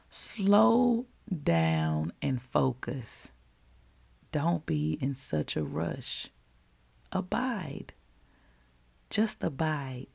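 soft and slow, 'Slow down and focus' stretched out; long silence before and after 'Abide'; hushed, deliberate 'Just abide', set apart; pausing for emphasis. Retold or imitated. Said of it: "I'm pausing for emphasis right now".

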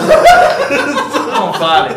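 stretched out, laughing; slow mock plea. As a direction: drag out not to say it. Dramatic mock warning, slow.

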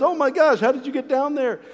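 high, startled friend's voice; acted astonishment. Friend finds him in hole.

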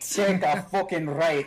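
exasperated Scottish sat-nav; Scottish accent. Pretend. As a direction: swear the direction in a Scottish brogue. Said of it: "Scottish brogue GPS".

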